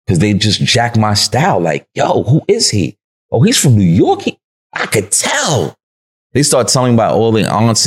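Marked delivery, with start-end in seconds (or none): 1.9-5.8 mock amazed onlookers. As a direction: play the onlooker marveling, stretch the last words. Imitating strangers spotting a New Yorker.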